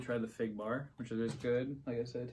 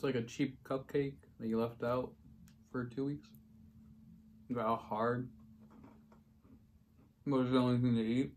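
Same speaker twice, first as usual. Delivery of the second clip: talking between bites; chewing while talking. Real, not acted. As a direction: mouth full of cookie. Seen 2: Holds cookie and talks with food in mouth, bites at 3.5-4.2s.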